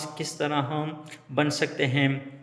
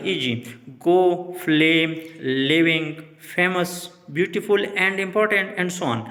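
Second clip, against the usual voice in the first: slow example words; slower than usual. Shown as a demonstration. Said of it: "teacher reading example words slowly".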